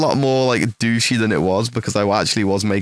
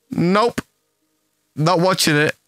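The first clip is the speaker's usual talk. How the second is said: defiant refusal; 6 semitones higher than usual. Retold or imitated.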